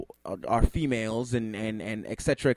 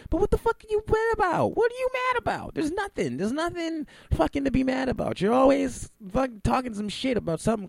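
incredulous; 11 semitones higher than usual. Real, not acted. Incredulous rant at Lupe.

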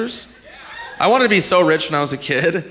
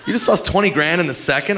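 ordinary delivery

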